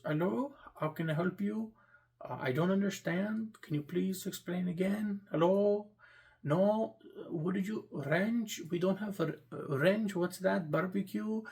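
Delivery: mock Indian accent on a confused service call. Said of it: "they have this accent".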